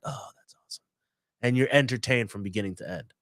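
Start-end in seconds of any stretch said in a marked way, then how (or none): 0.0-0.8 breathy whispered aside. Retold or imitated. Whispering his reaction while watching the movie. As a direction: whisper it, amazed.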